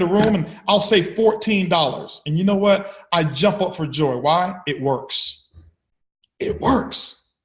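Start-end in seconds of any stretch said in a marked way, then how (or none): 6.4-7.3 breathy whisper, no voice. Shown as a demonstration. Speaker to audience, repeating the punchline in a whisper. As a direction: let the words fall to a hushed whisper.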